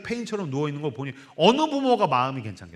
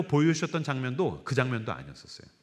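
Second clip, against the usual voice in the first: usual speaking voice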